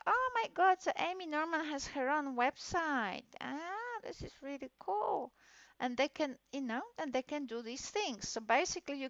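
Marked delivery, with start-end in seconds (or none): 0.1-3.2 high, loud gasp of delight; teacher amazed by the finished site; delighted surprise. Real, not acted. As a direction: burst out with real amazement. Sees the finished website.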